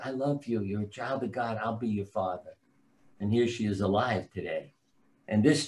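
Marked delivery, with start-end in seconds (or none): 0.0-2.6 soft, gentle retold reassurance; tender reassurance. Calling a woman who wanted to die, tender reassurance. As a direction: say it softly, as a caring promise.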